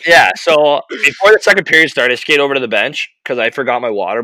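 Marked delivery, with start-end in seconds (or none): none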